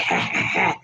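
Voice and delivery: breathy mock "ha, ha, ha"; put-on chuckle in separate ha's; imitated sly laugh. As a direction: imitate his cat-that-got-the-canary laugh. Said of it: "kind of laugh".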